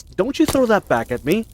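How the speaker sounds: high, loud indignant protest; high, loud mock indignation; indignant retort. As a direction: throw it back with mock outrage.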